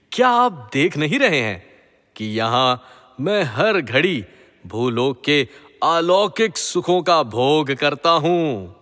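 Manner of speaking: usual speaking voice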